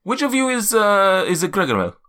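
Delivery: put-on high, searching accented giant; Zephyros. Giant sorting out which guest is which, high accented voice. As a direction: puzzle out the name in a high voice. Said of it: "my accent's just all over the place".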